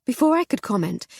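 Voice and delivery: usual speaking voice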